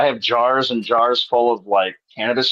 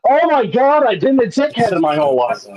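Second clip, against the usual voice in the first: mock stoned mother-in-law; 8 semitones higher, 3 dB louder than usual. Imagined high mother-in-law epiphany.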